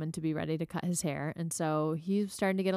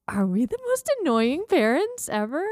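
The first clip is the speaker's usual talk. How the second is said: exasperated; 7 semitones higher, 7 dB louder than usual. Retold or imitated. Retold thought, self-mocking.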